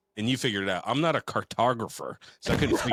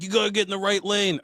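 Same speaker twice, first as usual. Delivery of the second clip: mock screaming; 9 semitones higher than usual. Gate workers screaming at the driver, high restrained mock yell. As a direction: mimic a screamed order by raising the pitch. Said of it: "they're screaming at me".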